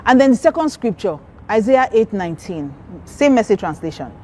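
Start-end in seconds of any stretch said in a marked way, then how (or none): none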